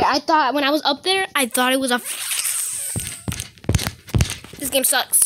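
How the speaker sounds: usual speaking voice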